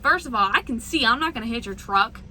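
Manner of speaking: ordinary talk, nothing marked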